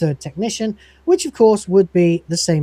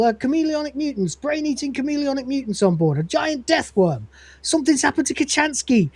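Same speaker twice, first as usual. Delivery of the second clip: higher, rapid guesses tumbling out; frantic charades guessing; 7 semitones higher than usual. Acted. The crew guess wildly at the Cat's charades, frantic and higher. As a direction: shout out guesses in a rush. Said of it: "each of them try to guess".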